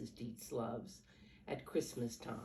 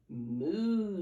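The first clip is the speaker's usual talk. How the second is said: cow moo; 4 semitones higher, 8 dB louder than usual. Acted. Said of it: "says the cow".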